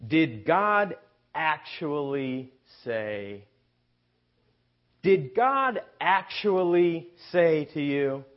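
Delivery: slow, sneering, mocking serpent question. Shown as a demonstration. Preacher reads Satan's question to the congregation, slow and mocking. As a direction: drawl it out like a taunting serpent. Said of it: "I'll read it how I think it ought to be read".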